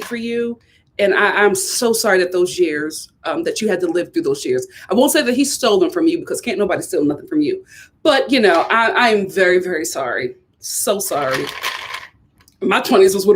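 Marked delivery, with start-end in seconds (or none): none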